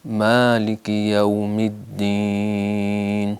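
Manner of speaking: مالک یوم الدین recited very slowly in a chanted style; careful tajweed chant; slow recitation. Shown as a demonstration. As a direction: draw the verse out for students. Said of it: "یہاں شد آنے کا خطرہ ہے".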